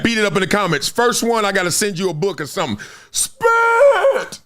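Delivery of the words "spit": high, drawn-out mock yell; big movie quote. Quizzing viewers on a movie, exaggerated high cry. Repeats movie line, bigger.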